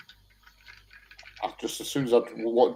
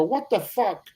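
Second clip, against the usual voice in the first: higher, sing-song Welsh lilt on "What the fuck?"; Welsh twang; 10 semitones higher, slower than usual. Shown as a demonstration. Showing friends his new Welsh catchphrase, Welsh twang. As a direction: put on a Welsh twang, pitch jumping up. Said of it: "but I even say it now with a Welsh twang".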